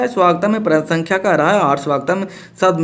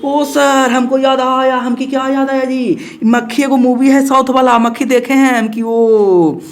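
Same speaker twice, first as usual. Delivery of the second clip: excited student; 6 semitones higher, slower than usual. Acted. Teacher acts excited student remembering movie.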